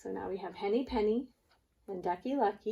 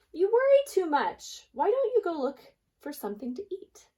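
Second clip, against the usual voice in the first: much higher, brighter duck voice, brushing off her worries; a chirpy friend telling her to lighten up; Ducky Lucky teasing; 9 semitones higher, 6 dB louder than usual. Acted. Ducky Lucky scolding Henny Penny.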